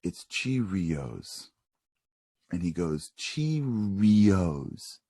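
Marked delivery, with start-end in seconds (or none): 0.0-1.5 very slow, soft and lower; mommy teaching. Acted. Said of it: "mommy says to him". 3.2-5.0 CHEERIOS drawn out, syllable by syllable; a kid's careful, stretched attempt at CHEERIOS; child sounding out. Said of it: "he goes a little bit longer".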